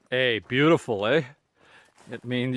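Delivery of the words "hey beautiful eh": Canadian "eh?" tagged on with a questioning lift. Shown as a demonstration. Vlogger teaching viewers the Canadian eh, asking lilt. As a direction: show off the Canadian "eh" that wants an answer. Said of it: "if I say".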